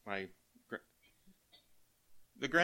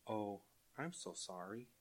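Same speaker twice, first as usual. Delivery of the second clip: soft sorry; 9 dB quieter than usual. Retold or imitated. Grandson consoles grandpa over car.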